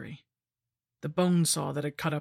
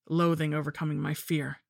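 ordinary delivery, nothing marked

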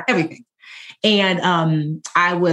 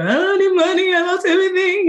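high, crying plea, pitched way up; bursting into tears; 13 semitones higher than usual. Retold or imitated. Confessing to her best friend on the phone, high tearful crying. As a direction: break down crying as you confess. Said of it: "I burst into tears".

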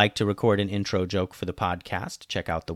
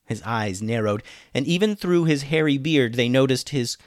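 ordinary delivery, nothing marked

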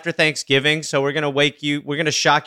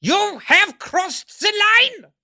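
put-on high, drawn-out German-accented scolding; hammed-up German outrage, very slow; stern mock German; slower than usual. Host scolding the guest, high mock-stern German accent. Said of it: "Do your German".

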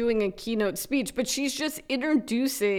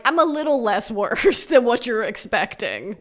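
ordinary delivery

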